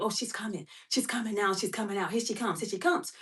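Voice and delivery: quiet urgent murmur to her partner; hushed tip-off. Retold or imitated. Woman quietly warning the driver, hushed. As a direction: keep it low, telling him she's coming.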